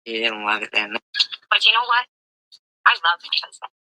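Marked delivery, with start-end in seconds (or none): 1.5-3.7 high, loud sing-song put-on wife voice; mocking imitation. Streamer mimicking the caller's proud wife, high and mocking. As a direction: ham up her smug words, pitched way up.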